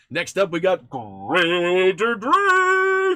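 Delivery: slow, loud, high name announcement; announcer callout. Acted. Announcing patron names.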